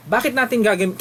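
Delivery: ordinary talk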